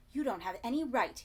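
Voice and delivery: blustering. A narrator's reading. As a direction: puff up with outrage. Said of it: "he began to bluster".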